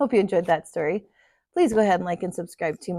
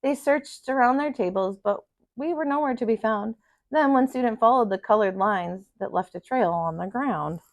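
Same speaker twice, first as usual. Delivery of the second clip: put-on steady storyteller reading to children; story narration; 2 semitones higher than usual. A narrator's reading. Teacher reading a picture book to her class, in a gentle storytelling voice. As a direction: read it out like circle time for kids.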